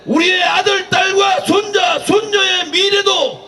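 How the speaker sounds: high, slow impassioned shouting; rally shouting. Real, not acted. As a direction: rouse the crowd to defend the future. Rally speaker's rousing appeal. (열정적으로 외치는 연설)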